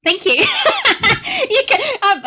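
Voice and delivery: high, loud, laughing delight; laughing thanks. Real, not acted. Learner praised by her teacher, laughing loudly with delight. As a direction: burst into laughter while thanking.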